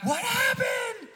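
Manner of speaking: high, long-distance shout, stretched out slow; yelling back. Retold or imitated. Friends calling back to stranded hiker, yelling. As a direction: holler the question back down the mountain. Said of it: "we yell back".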